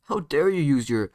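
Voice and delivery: indignant yell, jumping up in pitch; acted outburst, loud on each accusation. Sasuke accusing Naruto of cheating, yelling. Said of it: "Sasuke would start yelling at him".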